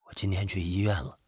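soft, low confession; low, subdued. A narrator's reading. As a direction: hold the sorrow down and speak low. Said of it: "低低地说".

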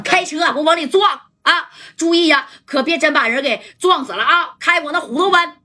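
commanding order. Retold or imitated. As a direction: urge the drivers to ram straight in. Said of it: "戴哥就说了". (提高嗓门下命令)

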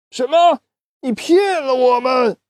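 high, shocked and angry; indignant outrage. Acted. Chickens learning they were tricked, high and indignant. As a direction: accuse the liar.

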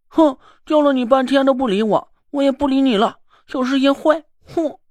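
put-on high, pouty sulking child voice; a spoiled kid in a huff; angry little child. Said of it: "泥娃娃生气了". (生气撒娇的小孩声音)

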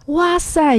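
高声惊喜地夸孩子, 音调明显上扬; 夸张地惊叹九十四分; 惊喜夸奖. Shown as a demonstration. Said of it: "我们现在就给妈妈做一个示范". (给妈妈示范如何夸奖孩子, 高声惊喜)

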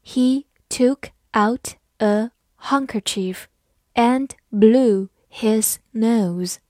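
slow and deliberate, each word separate; slow teacher's model reading. Shown as a demonstration. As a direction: read it word by word for learners. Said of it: "好，慢慢来读".

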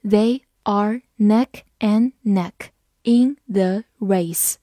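slow, separated words, uncontracted; slow read-through. Shown as a demonstration. Teacher rereads the race sentence for learners, slow read-through. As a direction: read it word by word with clear gaps. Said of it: "好，我们慢读一遍".

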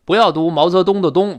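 normal talking voice

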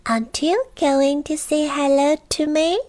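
high-pitched little goblin; high, teasing little voice. Acted. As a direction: ask it in a small, high creature voice. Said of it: "a little voice".

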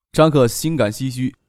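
ordinary delivery

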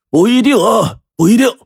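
put-on high-pitched old patriarch cutting in; old man interjecting. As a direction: call it out from the doorway. 老爷子在门口插话. (老爷子高声插话)

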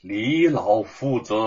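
slow and deliberate; name him slowly, letting it sink in. Acted. Li Chunfang naming the old teacher to Wu, slow. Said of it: "李春芳慢慢地说".

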